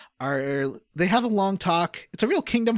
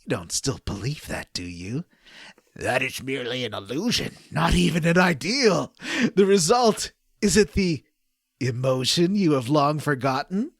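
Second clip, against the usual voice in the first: hammed-up game dialogue. Reading game script aloud to co-hosts, hammed-up dramatic voices. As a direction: overact the villains' lines while trying not to laugh.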